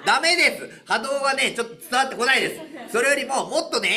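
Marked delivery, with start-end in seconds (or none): none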